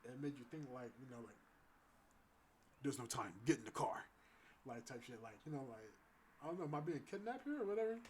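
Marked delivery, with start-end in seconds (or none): 2.8-4.1 stern feisty command. Retold or imitated. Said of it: "so feisty and so, like, stern". 6.4-8.0 mock alarm. Real, not acted. Joking panic about kidnapping.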